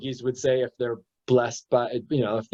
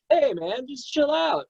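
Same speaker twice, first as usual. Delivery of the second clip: much higher breezy voice; airy mock advice to the hurt man; mock casual advice; 11 semitones higher than usual. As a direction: toss off a careless chill out. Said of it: "tell him".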